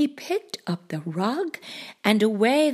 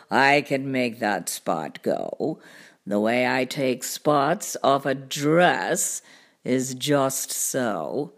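low, slow cartoon cat voice; deep, leisurely show-off cat; boastful cat; 10 semitones lower, slower than usual. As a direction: brag slowly, deep and easy.

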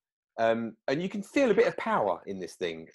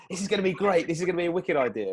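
high, quick and eager; mock hype, pitched up; excited; 7 semitones higher, faster than usual. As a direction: sell the big idea, buzzing. Excited quote of themselves.

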